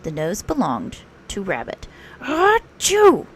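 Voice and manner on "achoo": loud high sneeze; a big sudden achoo. A narrator's reading. Rabbit sneezing at a leaf on his nose, loud and high. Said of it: "said Rabbit".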